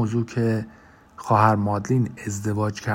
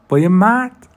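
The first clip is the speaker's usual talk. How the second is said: high, loud disbelieving question; surprised; 7 semitones higher, 7 dB louder than usual. Acted. Clive amazed that Sister Madeleine was married, high and loud. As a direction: blurt it out in shock.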